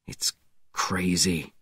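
low, quiet voice. A narrator's reading. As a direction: say it under the breath. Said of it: "Webb said quietly".

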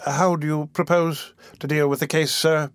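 usual speaking voice

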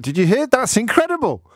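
high, rising astonishment; gleeful disbelief; amazed. Real, not acted. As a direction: marvel at it, voice leaping up. Marvels at his own accent trick.

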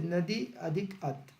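slowly spelling out the split word by word; slow, deliberate explanation of the split; slow breakdown. Shown as a demonstration. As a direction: lay out each part slowly for the class. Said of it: "बघा ना तुम्ही".

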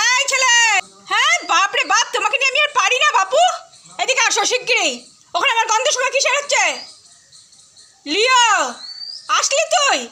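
high, fond exasperation at the dog; exasperated pet talk. Real, not acted. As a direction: scold the dog lovingly. Calling dog back, exasperated.

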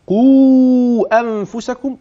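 قوا held long, pitched high; four-count madd. Shown as a demonstration. Sheikh showing the separated madd, high and held. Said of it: "وهمد أربعة".

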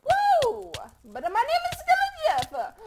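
high, loud triumphant whoop; excited cheer. Real, not acted. Cheering loudly to the viewers after a win. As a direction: cheer it out like you just won.

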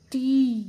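टी loud, with a long ee; long-vowel demonstration. Shown as a demonstration. Teacher joins letter and matra, long loud टी. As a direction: stretch टी out loudly. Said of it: "बड़ी की मात्रा".

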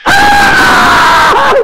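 sudden piercing high shriek; hammed-up scream from a burnt mouth; scream of pain. María tasting the burning soup, sudden loud scream. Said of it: "Y dijo".

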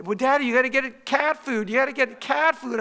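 put-on high, insistent kid voice; imitating begging children. Daughters nagging Dad to buy cat food, high mock pleading. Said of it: "they begged me".

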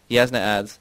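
ordinary delivery

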